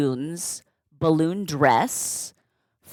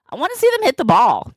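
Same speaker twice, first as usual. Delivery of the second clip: put-on kid voice; 7 semitones higher, 5 dB louder, much faster than usual. Six-year-old voiced waiting for ball.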